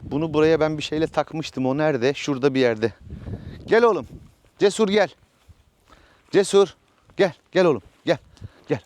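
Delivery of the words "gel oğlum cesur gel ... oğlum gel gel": calling the horse. Real, not acted. Owner calling his horse Cesur over, raised and higher. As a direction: call him over from across the yard.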